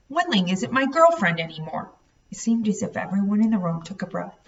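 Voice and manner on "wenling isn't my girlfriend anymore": usual speaking voice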